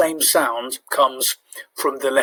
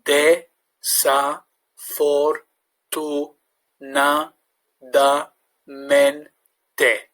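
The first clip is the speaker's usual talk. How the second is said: syllable by syllable, very slow; sounding out words; much slower than usual. Shown as a demonstration. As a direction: break Desafortunadamente into slow syllables. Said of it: "how to break it down into syllables".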